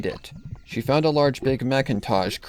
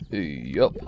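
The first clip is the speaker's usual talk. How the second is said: much lower, laconic big brother; deep slow farmhand grunt of agreement; Big Mac voice; 8 semitones lower than usual. Acted. Big Mac's one-word reply.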